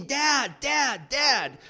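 high, loud frantic calls; screaming for Dad. Retold or imitated. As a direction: call Dad over and over in panic. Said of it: "I start screaming".

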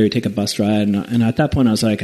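normal talking voice

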